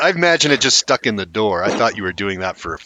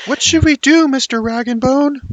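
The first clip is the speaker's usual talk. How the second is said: put-on small child voice; 3 dB louder than usual. Child voice in play.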